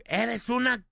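high-pitched yell broken off midway; retold yell. Host re-enacting his friend's outburst in the exam, retold yell. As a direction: start the shouted insult, then stop. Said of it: "gritoneó".

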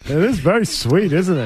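lightly spooky Count voice; vampire voice. Pretend. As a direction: purr it like a cartoon vampire. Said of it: "Count Chocula".

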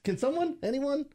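high, pleading disbelief; exasperated plea. Real, not acted. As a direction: throw it up high, begging anyone.

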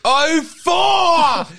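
loud, high gotcha call; triumphant shout. Real, not acted. Correcting the guess, triumphant.

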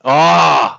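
loud fan's cry of relish; delighted cry. Real, not acted. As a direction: burst out with a thrilled "ah". Delight at favourite film moment.

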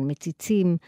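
usual speaking voice

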